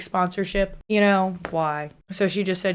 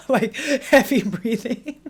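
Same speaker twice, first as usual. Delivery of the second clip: a squeaky, wheezy imitation of heavy breathing; mock heavy breathing; 5 semitones higher, slower than usual. Streamer imitating the egg-costumed husband with mock heavy breathing. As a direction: puff and wheeze like a man stuck in an egg costume. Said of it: "And he would just be like".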